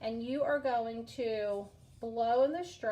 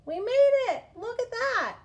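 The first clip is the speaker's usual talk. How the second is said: very high, loud cheer; triumphant; 12 semitones higher, 10 dB louder than usual. Real, not acted. Teacher to pupils as the caterpillar finishes, triumphant. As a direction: celebrate crossing the finish line.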